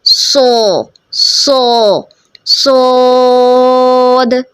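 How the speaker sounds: सो, सो, then सोद held long and heavy; heavy saad built up. Shown as a demonstration. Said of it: "ये heavy letter है".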